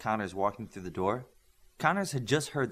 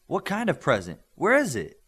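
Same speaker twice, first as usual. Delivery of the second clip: higher, louder eager questions; excited; 7 semitones higher, 7 dB louder than usual. Acted. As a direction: bounce with eagerness. Eager teens asking about present.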